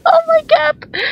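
high, squealing laugh; cracking up in surprise; laughing exclamation. Real, not acted. Laughing, startled by game.

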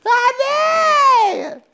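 very high drawn-out comic squeal; squealed catchphrase. Acted. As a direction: push it way up and stretch it out. Running catchphrase pushed to a squeal.

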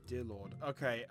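ordinary talk

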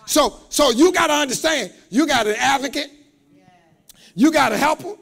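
loud, high, shouting preacher's build; preaching. Acted. Preacher shouting to the congregation, full force.

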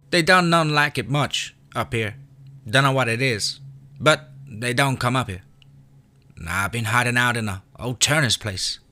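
put-on high rural kid explaining; a boy sharing his hiding place; high child's voice; country boy. A narrator's reading.

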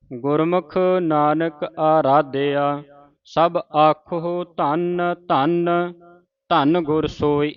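slow, brief hold on the second ਧੰਨ; short stop on the second ਧੰਨ; half pause on second ਧੰਨ. Shown as a demonstration. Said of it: "ਦੂਜੇ ਧੰਨ ਤੇ ਮਾਮੂਲੀ ਜਿਹਾ ਰੁਕਣਾ ਹੈ".

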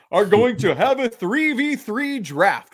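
drawn-out mock announcer intro. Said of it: "this is my announcer voice".

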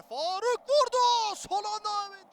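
excited commentator. Real, not acted. Shot on goal, live commentary.

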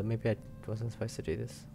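usual speaking voice

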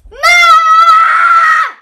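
put-on scared child calling for mom. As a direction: yell for mom, high and loud. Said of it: "Why are you shouting for Mom?".

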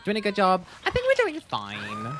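high chirpy fake-cheerful "fine"; singsong. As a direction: sing it out with a rise on "fine". Singsong reassurance vs flat correction.

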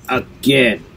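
usual speaking voice

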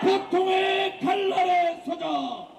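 high, slow shouted battle cries; roaring rallying speech. Real, not acted. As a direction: fire up the comrades, no fear. (힘차게 외치는 투쟁 구호)

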